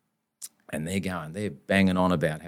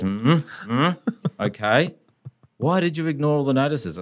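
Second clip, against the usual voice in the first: higher, commanding hums and a clipped question; General Patton-like lawyer; 8 semitones higher, slower than usual. Retold or imitated. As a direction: strut it like a general grilling a private. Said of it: "strutting around like bloody General Patton".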